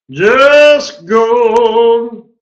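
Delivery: Just gone belted out high and loud; excited shout. Real, not acted. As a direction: shout it like the trade just exploded. Trader repeats exclamation about trade.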